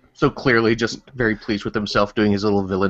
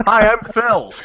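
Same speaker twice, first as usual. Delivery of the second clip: mock podcast intro; 9 semitones higher, 4 dB louder, slower than usual.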